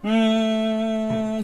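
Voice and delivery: long drawn-out low humming; stretched bee buzz; bee hum. Shown as a demonstration. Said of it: "मधुमक्खी देखते हैं".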